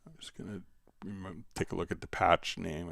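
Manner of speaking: ordinary talk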